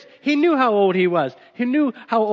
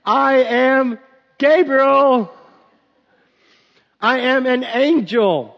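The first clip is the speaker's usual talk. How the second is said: higher, louder, slow and insistent angel; emphatic Gabriel; 4 semitones higher, 3 dB louder, slower than usual. Pretend. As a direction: spell it out grandly to a doubter. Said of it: "he probably didn't use that inflection".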